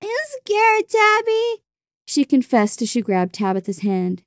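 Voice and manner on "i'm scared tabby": tiny, very high frightened voice; high-pitched fearful confession; scared, crying child. A narrator's reading. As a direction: squeak it out like a scared little girl. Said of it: "She started to cry".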